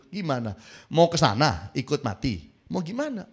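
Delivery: ordinary delivery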